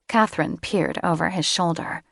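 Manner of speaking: usual speaking voice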